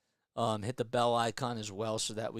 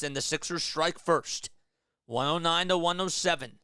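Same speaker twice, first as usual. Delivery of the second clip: live play-by-play; 5 semitones higher, 5 dB louder than usual. Acted. Resumes overtime play-by-play.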